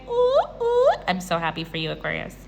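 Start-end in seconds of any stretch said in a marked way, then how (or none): 0.1-0.9 high-pitched gleeful "ooh, ooh"; two high hooting oohs; teasing oohs. Real, not acted. Reader teasing Aquarius about a new lover, gleeful oohs. Teasing cheer over the romance card.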